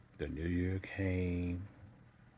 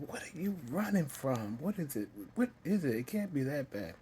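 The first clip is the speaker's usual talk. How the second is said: high-pitched, distressed retelling of mom's questions; pleading mother; 8 semitones higher than usual. Retold or imitated. Mom asking what the child runs from, pleading. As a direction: press urgently, voice pitched way up and near tears.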